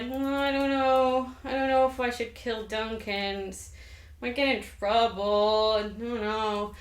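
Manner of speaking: slow, drawn-out mock reluctance; dithering Macbeth. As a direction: drag the words out, whining with doubt. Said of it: "Macbeth is still like".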